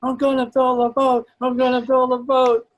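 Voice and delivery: high, loud imitation of a determined kid; excited boy shouting. Collapsed boy looking up at the storyteller, excited shout. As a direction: cry it out like an elated boy. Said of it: "He's shouting at the top of his lungs".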